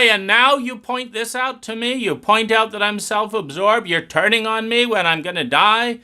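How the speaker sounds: high, frantic voice of self-pity; acting out the traumatized self-absorbed person who is called out, in high panic. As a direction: play someone sure they're about to die and hurt that you noticed. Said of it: "They just go into more self-absorption".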